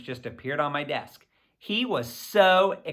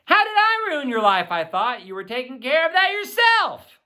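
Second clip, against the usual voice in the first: higher, teasing brother's retort; sibling comeback; 8 semitones higher, 3 dB louder than usual. A narrator's reading. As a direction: snap back at your sister with a tease.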